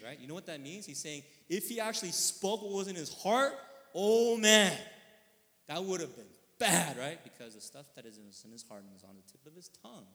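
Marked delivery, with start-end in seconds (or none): 1.5-7.0 loud, high burst peaking on "oh man"; emphatic exclamation. Real, not acted. Preacher warning what speaking his heart would mean, emphatic exclamation. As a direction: belt it out in dramatic alarm.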